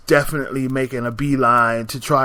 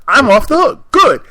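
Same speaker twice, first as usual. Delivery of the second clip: much higher and louder, near squeal; gleeful relief; 10 semitones higher, 9 dB louder than usual. Retold or imitated. Repeating her escape from the man, in a high loud gleeful squeal. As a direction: celebrate the escape.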